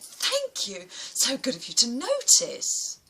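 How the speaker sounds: answering a girl's insult with high, mock-sweet thanks; mock gratitude. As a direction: thank her sweetly, dripping with sarcasm. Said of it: "turned around and went".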